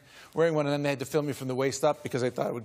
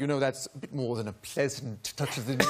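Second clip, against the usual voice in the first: put-on British accent; 4 semitones lower, 4 dB quieter than usual. RSC actors remarking to a newcomer, lower British accent. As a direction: lower British thespian imitation. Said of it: "A couple of guys from the R S C".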